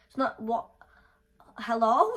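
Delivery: loud, incredulous hello; indignant yell. Retold or imitated. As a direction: shout it at him in disbelief. Angrily confronting laughing friend.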